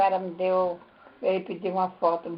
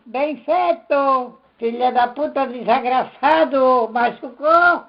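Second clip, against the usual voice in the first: high, loud, slow gloating shout; gleeful yelling; 5 semitones higher, 8 dB louder, much slower than usual. Retold or imitated. Grandmother yelling at the bleeding husband, gloating shout. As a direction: yell it out, gloating over his wound. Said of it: "a tua bisavó gritava assim".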